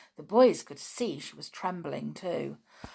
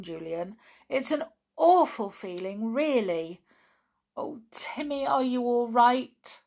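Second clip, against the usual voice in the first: a touch of loud, higher-pitched distress; groaning in distress; 5 semitones higher, 7 dB louder than usual. A narrator's reading. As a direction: lament it with feeling. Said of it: "put her head in her hands and groaned".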